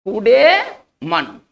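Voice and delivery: कूडेमन drawn out very slowly, syllable by syllable; pronunciation demo. Shown as a demonstration. Teacher showing the class how to say कूडेमन, very slow. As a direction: spell out कूडेमन slowly and clearly, koo-de-man. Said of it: "इसका उच्चारण इस तरह से करेंगे".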